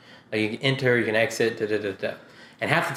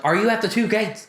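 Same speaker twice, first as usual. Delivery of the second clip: higher, exasperated question; 7 semitones higher than usual. Retold or imitated. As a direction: ask it like you've asked ten times.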